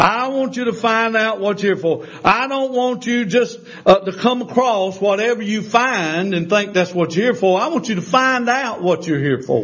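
rising, insistent preacher's exhortation; fervent preaching. Acted. Preacher urging the congregation, insistent and climbing. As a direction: press the point home to the flock.

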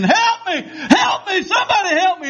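high, drawn-in-agony imitated cries; retold screaming. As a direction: scream it as a soul waking in hell. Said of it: "still screaming".

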